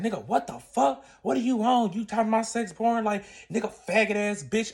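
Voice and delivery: heated mock tirade; angry outburst. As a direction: act out an indignant, cussing boyfriend. Acting out boyfriend's angry outburst.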